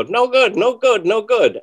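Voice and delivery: high, loud, curt repeated dismissal; mentor's "no good" imitated high and loud; imitating mentor's verdict. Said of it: "and he'd go".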